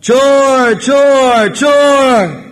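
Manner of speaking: loud high drawn-out cry of chor; shouting alarm. Acted. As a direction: raise the alarm to the whole village, high and loud. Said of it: "he would start shouting".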